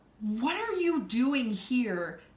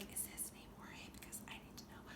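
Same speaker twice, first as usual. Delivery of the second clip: sarcastic whispered aside; conspiratorial whisper; 24 dB quieter, faster than usual. Retold or imitated. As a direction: murmur it low, mock-serious.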